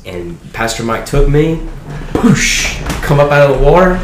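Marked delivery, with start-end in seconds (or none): 2.1-2.8 high breathy whoosh of water; whoosh sound. Retold or imitated. Pastor dunking him in the water, a high whoosh. 3.0-4.0 coming up excited. Retold or imitated. Coming up from baptism water.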